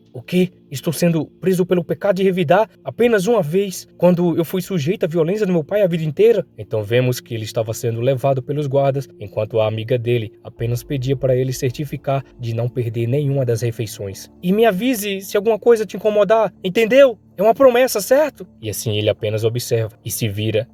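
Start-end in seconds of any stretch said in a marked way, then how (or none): 0.1-6.4 high-pitched incredulous protest; acted disbelief, pitch raised throughout; shocked. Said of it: "ele fica assustado". 14.4-18.5 high, loud, insistent plea; acted girl's urgent shout; urgent calling out. Friend calls out as he's taken.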